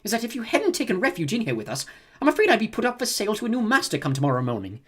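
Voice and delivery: high, fussy whining droid; whiny voice. Acted. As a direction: fret like a nervous butler robot. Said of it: "commented in a whiny voice".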